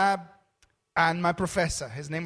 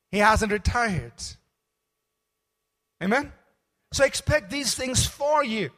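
raised, emphatic preaching; 5 semitones higher, 5 dB louder than usual. Acted. Preacher urging the church to expect miracles, raised and emphatic. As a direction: drive it home to the congregation.

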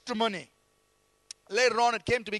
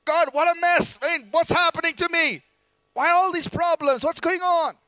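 higher, louder, exasperated complaint to God; complaining psalmist; 5 semitones higher, 4 dB louder than usual. Acted. Preacher voicing the psalmist complaining to God, exasperated. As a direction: vent the psalmist's frustration upward. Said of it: "So the psalmist is complaining".

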